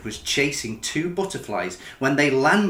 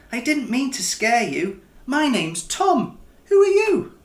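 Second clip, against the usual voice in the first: higher, lightly voiced young boy, friendly and curious; little boy Tom; 9 semitones higher, 3 dB louder than usual. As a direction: play the small boy meeting a snowman.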